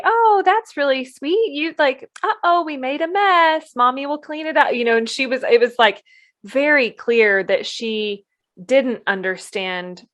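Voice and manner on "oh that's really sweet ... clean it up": much higher, sugary mock-mom tone; overly sweet mom voice. Mocking a gentle parent cooing at kids about a mess. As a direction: parody a syrupy parent cooing at a messy kid. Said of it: "Just say".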